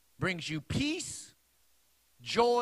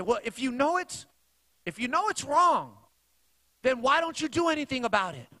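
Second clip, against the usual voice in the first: pressing challenge; 6 semitones higher than usual. Retold or imitated. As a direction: push her hard with each why. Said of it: "I say".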